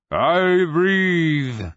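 high, loud croaking dragon boom. A narrator's reading. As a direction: boom it out like an ancient dead dragon. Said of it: "croaking voice boomed".

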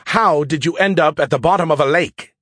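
loud, high incredulous question; can't believe what he hears. A narrator's reading. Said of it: "he asked incredulously".

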